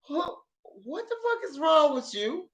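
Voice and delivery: retold hushed bewilderment; imitated stunned disbelief. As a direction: ask it under your voice, appalled. Friend's stunned question in car.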